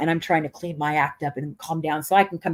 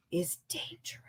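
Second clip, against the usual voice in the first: whisper; 14 dB quieter than usual. Acted. Dramatic ominous payoff.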